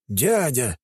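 startled, falling Дядя; Дядя in shocked disbelief; surprised. A narrator's reading. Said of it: "удивился он".

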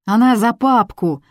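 high, loud call from the crowd; shout from the crowd. A narrator's reading. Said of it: "раздалось из толпы".

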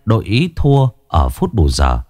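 plain reading voice

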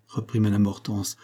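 ordinary delivery, nothing marked

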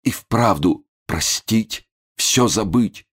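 slow, high, tearful resignation; shaken. A narrator's reading. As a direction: dwell on each phrase as if moved to tears. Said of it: "Момус потрясённо смахнул слезу". (потрясённо, медленно, со слезой)

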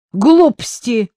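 high, loud, drawn-out dismissal; cutting him off. A narrator's reading. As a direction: dismiss it firmly. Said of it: "отрезала Дуся".

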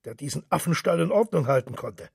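mildly heated, building to a commanding outburst; fired-up speech rising into mock dog-handler commands. A narrator's reading. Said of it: "sagte Mister Henchy hitzig".

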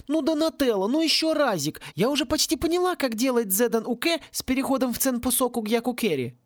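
put-on high-pitched, excited girl's voice; high, wheedling enthusiasm; eager begging child. A narrator's reading. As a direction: coax excitedly, pitched well up. Excited girl begs turtle for more.

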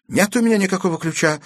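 quick, high, firm denial; fearless. A narrator's reading. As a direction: declare it boldly to the threat. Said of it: "бесстрашно заявила Аглая". (бесстрашно, с вызовом)

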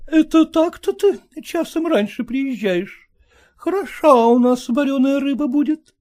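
high, reproachful scolding wife. Acted. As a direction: nag him, high and sharp. Wife scolding late husband.

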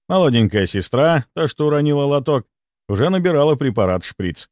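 ordinary delivery

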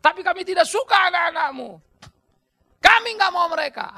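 high voice, building to loud protest; Israelites petitioning. Acted. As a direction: play a people complaining to their leader. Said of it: "Orang Israel datang".

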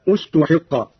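استحق begun with u, "ustuhiqqa"; initial damma. Shown as a demonstration. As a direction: start استحق on a clear u vowel. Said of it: "مع ملاحظة البدء بضم الهمزة".

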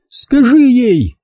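high cry, drawn out; shouted order. A narrator's reading. Mother cutting in to make son speak, shouted. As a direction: cry out the command high and slow. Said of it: "крикнула мама".

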